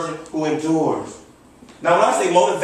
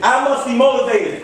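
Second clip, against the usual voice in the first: raised, rallying pitch; with more passion; 5 semitones higher than usual. Shown as a demonstration. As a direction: lead the chant with excitement. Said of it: "I don't feel you yet".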